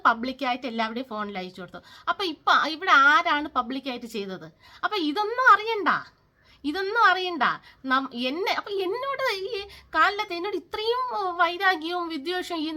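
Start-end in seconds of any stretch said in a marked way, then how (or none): 4.8-7.5 raised, higher, louder protest; indignant outburst. Real, not acted. Ranting vlogger to gossiping neighbours, indignant raised voice. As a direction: throw it back at them, fed up.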